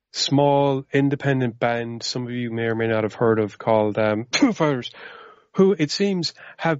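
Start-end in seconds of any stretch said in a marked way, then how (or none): 4.0-4.9 cough over the name. Pretend. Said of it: "You seemed to have coughed while you were saying the name of the band".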